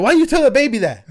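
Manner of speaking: high, loud indignant scolding; wife's scolding. Retold or imitated. Dad imitating his wife scolding him, high and loud. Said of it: "And she's like".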